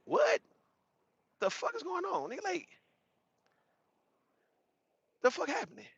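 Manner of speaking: like the ground just dropped out under him; bewildered shock. Retold or imitated.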